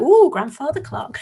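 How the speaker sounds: high "ooh" of wonder; imitated children's awe. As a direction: mimic impressed children cooing. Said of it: "the children are like".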